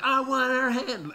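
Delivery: high, slow whine; whiny child. Retold or imitated. Bryn pleading at the school drop-off, high slow whine. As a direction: stretch it out like a pouting kid. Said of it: "then Bryn's like".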